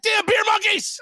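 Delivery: high squeaky cry of mock dismay. Host acting the hungover morning after, mock dismay. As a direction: squeal it like waking up robbed. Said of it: "it's like".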